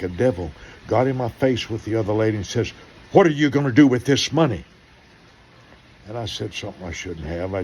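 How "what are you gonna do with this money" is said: higher, louder, fast demand; mock snappy bank manager; hostile manager. Bank manager confronting the pastor, loud and fast.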